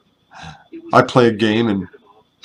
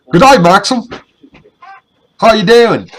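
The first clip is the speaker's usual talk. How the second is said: high, loud mock British greeting; British accent impression of the clan leader; 11 semitones higher, 9 dB louder than usual. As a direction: imitate a cheery British accent. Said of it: "in his British accent".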